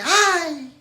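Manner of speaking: imitated child's sudden cry, high and loud; mock a boy's yelp from upstairs; grito de niño. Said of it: "el niño de repente grita". (grito imitado de un niño)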